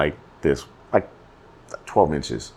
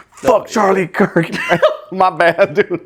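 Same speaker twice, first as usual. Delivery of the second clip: high, slow words through a laugh; laughing jab, slowed and pitched up; laughing outburst; 13 semitones higher, 4 dB louder, slower than usual. Real, not acted. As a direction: blurt it out while cracking up.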